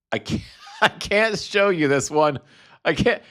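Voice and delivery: breaking into giggles mid-line; laughing too hard to get it out; real helpless laughter. Real, not acted. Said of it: "Most of this show has been me laughing".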